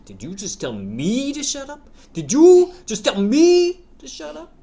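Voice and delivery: bad gangster impression, rising to a yell; mock gangster. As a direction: do a terrible mafia boss. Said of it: "it should sound more like this".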